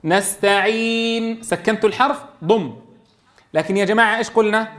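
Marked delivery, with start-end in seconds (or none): 0.0-1.4 slow "نستعين" with the final نون stilled, then silent lip rounding; "نستعين" held long, the lips close round at once after the نون; ishmam demo. Shown as a demonstration. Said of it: "فضم شفتيك بعيد إسكان الحرف".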